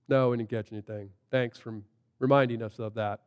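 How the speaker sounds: low, very soft grumble; grudging answer under the breath; sullen reply. Retold or imitated. Empty-netted fishermen answer the stranger, sullen and low.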